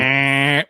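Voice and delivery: long nasal "ehh" like a game-show buzzer; imitated buzzer. Host to contestant who ran out of time, imitated buzzer. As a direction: mimic a wrong-answer buzzer.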